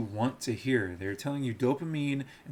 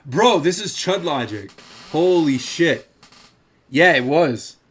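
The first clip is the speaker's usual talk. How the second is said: much louder, higher exclamation; shocked; 5 semitones higher, 11 dB louder than usual. Real, not acted. Reacting on stream to seeing himself in a video, loud and shocked. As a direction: blurt it out in disbelief.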